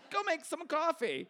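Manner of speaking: high, bossy order to an assistant; bossy movie boss. Retold or imitated. New hire bossing her assistant around like a movie executive, bossy. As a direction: play the big-shot boss from the movies. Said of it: "like in the movies".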